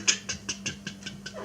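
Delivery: quick voiceless tongue ticks; soft, rapid tick noises, no voice; imitated ticking. Imitating the man running to look it up, ticking noises. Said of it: "he's fucking here running. He goes".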